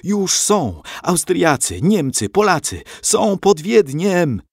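high, urgent cry of alarm; frantic shout. A narrator's reading. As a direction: shout the news like a man possessed, stretching the last words. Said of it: "Krzyczał jak opętany".

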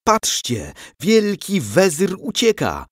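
high, excited crowd cry; jubilant calling out. A narrator's reading. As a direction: call everyone to look, elated. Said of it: "wołali mieszkańcy Wiednia".

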